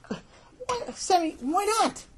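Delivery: very high, squeaky whine; whiny pleading. Acted. Boy asking his stubborn brother for a reason, high whine.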